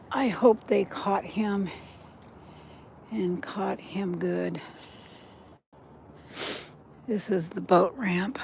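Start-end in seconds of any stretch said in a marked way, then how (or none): 3.1-8.4 whisper. Real, not acted. Whispered asides on walk.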